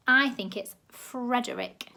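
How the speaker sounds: usual speaking voice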